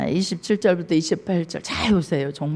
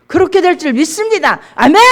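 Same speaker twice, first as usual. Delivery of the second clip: high, loud declaration of faith; fervent confession; 8 semitones higher, 9 dB louder than usual. Acted. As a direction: shout it out as a believer's answer. (크고 확신에 찬 신앙 고백)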